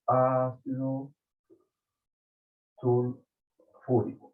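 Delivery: sulfúrico with stress on the U, slow; ácido sulfúrico, acento en la U. Shown as a demonstration. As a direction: say sulfúrico slowly, leaning on the U. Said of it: "con acento en la U".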